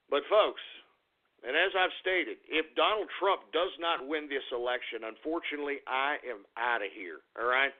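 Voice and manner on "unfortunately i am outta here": normal talking voice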